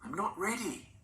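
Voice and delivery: high, desperate cry of refusal; a grandmother begging not to be taken; frightened refusal. A narrator's reading. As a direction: plead against going.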